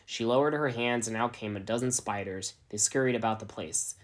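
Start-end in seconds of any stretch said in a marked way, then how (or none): none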